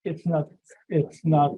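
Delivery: ordinary talk, nothing marked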